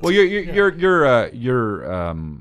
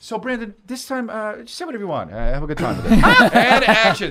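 director voice; 10 semitones higher than usual. Retold or imitated. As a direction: play the film director. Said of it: "The director like this".

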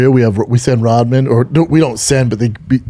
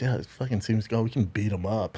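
hushed quote; 4 semitones lower, 16 dB quieter than usual. Retold or imitated. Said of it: "I'm like".

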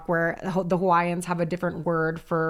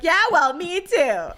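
high, loud acted comeback; mock irritable retort; 10 semitones higher, 5 dB louder, slower than usual. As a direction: snap back playfully, as in a friendly spat. Acts out irritable phone exchange.

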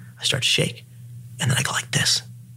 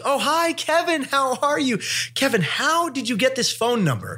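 very high, put-on woman's voice; exaggerated high-pitched phone greeting; mock-cheery startled date. Date caught off guard by Kevin's call, mock-cheery.

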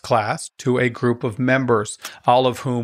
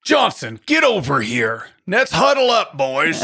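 football coach; 5 semitones higher than usual. Pretend. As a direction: bark it like a coach at practice. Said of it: "the football coach was asked to teach".

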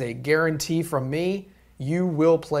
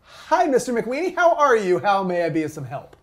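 fake cheerful; 5 semitones higher, 5 dB louder than usual. Sudden fake cheer for boss.

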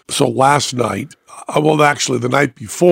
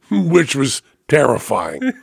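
normal talking voice

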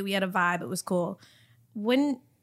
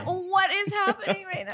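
high-pitched incredulous outcry; retold bewilderment; 10 semitones higher than usual. Woman retelling his sudden exit from the couch, bewildered. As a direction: exclaim it in baffled disbelief.

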